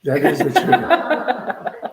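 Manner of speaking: laughing agreement. Real, not acted.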